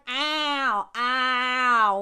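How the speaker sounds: drawn-out hammed-up ow; imitated cry of pain; mock pained "ow". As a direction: moan like a creaky proposer in pain. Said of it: "he's literally like".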